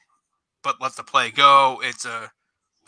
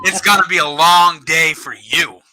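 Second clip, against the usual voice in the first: higher-pitched, emphatic mock comeback; defiant retort; 4 semitones higher, 4 dB louder than usual. Coach turning the ref's warning back on him, defiant retort. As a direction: throw the warning back, leaning on each word. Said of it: "And I'm like, "No, no, sir".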